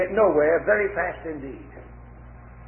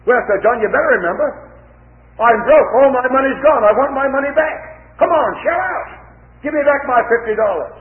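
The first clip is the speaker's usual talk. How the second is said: louder and higher, pleading turning to demand; insistent demanding shout; 6 semitones higher, 5 dB louder than usual. Acted. John shouting at Mister A for his fifty dollars, loud demand. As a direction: broke and desperate, shout for your money back.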